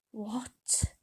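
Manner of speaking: ordinary delivery, nothing marked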